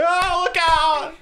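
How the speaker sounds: high, slow mock alarm; panicky mom. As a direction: cry out like a frightened mom.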